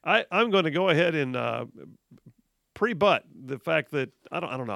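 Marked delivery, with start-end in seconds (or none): none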